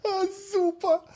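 high, delighted squeals; thrilled and laughing; gleeful. Real, not acted. Gleeful at the news.

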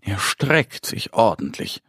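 ordinary delivery

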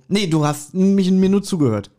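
normal talking voice

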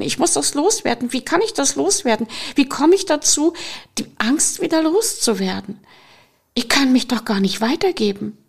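mounting panic. Acted. Fear trapped in the room, talking herself into panic.

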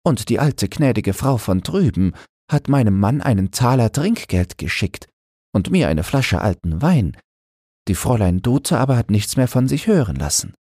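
Annemarie telling. Acted. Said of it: "sagte Annemarie". (Annemarie, höhere Frauenstimme, ruhig erzählend)

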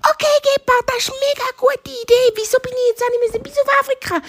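very high, fast hare voice; delighted little hare. Acted. Hare likes the cheetah's idea, high and quick. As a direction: gush quickly in a tiny voice.

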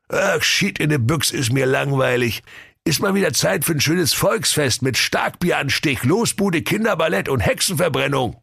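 a spoiled king moaning; bored king. Acted.

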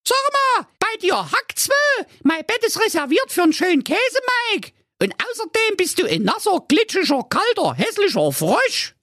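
put-on very high squeaky girl, scolding; disgusted squeal of a spoilt girl; indignant princess calling out. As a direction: tell off the frog in piping outrage. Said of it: "Und die Carola rief".

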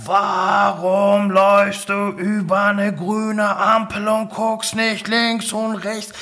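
high, slow, ringing call; loud booming voice. Retold or imitated. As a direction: boom it out like a voice from above. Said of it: "So laut, Thomas, so laut". (laut dröhnende Stimme)

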